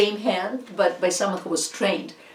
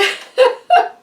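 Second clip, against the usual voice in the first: high burst of laughter; 8 dB louder than usual. Real, not acted. Laughing, trying to resume.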